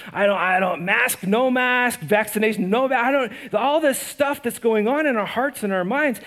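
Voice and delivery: impassioned sermon climax, climbing in pitch and volume; preaching at full force. Acted. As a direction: preach it with rising urgency, voice lifted. Preacher building to passionate peak.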